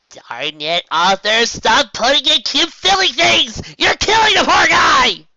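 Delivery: loud, high, slow scolding; exasperated shout. Acted. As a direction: yell at him in frustration. Exasperated outburst at the author.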